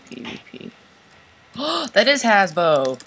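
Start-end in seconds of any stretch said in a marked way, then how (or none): 1.5-3.0 gasp, then loud and slow; shocked recognition, drawn out; surprised gasp. Real, not acted. Recognising a player, gasping.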